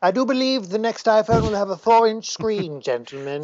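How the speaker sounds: lightly voiced pompous gentleman; posh colleague voice. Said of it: "he says to his soft toys".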